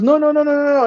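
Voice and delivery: high, rapid-fire string of noes; retold dismissive protest. Retold driver laughing off worry.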